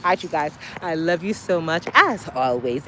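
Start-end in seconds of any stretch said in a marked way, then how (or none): none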